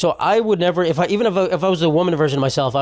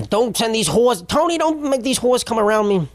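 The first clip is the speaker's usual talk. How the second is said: higher New Jersey mob-wife accent, a bit hammed-up; Carmela Soprano impression; 7 semitones higher than usual. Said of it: "Carmela Soprano".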